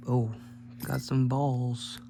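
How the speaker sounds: normal talking voice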